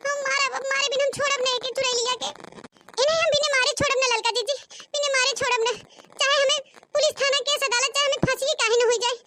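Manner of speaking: hammed-up fury; angry vow. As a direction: rush out the threat in rage. Vows to beat the schemer.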